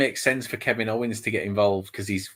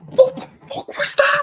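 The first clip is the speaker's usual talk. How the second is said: high, loud, stunned disbelief; shocked; 6 dB louder than usual. Real, not acted. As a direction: yelp it in total shock. Stunned reaction to a shocking move.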